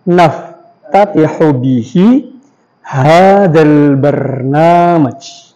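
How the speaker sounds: very slow, drawn-out Arabic dictation. Shown as a demonstration. Teacher dictating an Arabic sentence for students to write down. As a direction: stretch every syllable so students can write. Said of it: "ulangnya aja terus".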